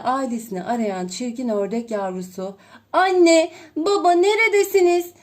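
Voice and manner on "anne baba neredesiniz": loud, high plaintive calling; whimpering. A narrator's reading. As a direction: call for lost parents, pleading. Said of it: "diyerek sızlanır". (yavru ördek sızlanarak sesleniyor)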